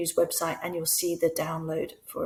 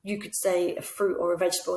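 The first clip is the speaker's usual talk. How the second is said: normal talking voice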